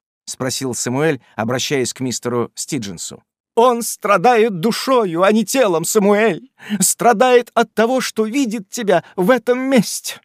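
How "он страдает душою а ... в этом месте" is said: indignant woman voice. A narrator's reading. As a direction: reproach him in a high voice. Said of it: "отвечала миссис Уэллер".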